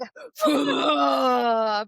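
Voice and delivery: loud mock gasp for air; imitated gasp. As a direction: gasp like a fish out of water. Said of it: "gasping for air like a fish".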